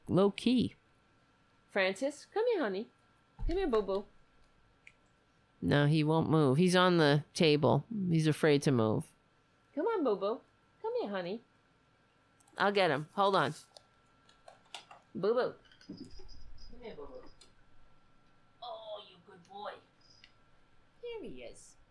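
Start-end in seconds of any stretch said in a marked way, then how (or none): 1.7-4.0 high, soft coaxing to a pet; soft coaxing call to the cat. Real, not acted. Owner softly calling her cat Francis off the table. 9.8-11.4 high, soft coaxing to a pet; soft coaxing call to the cat. Real, not acted. Owner softly calling her cat Francis off the table. 15.1-21.8 whispered cat coaxing. Real, not acted. Owner whispering to her cat while picking him up.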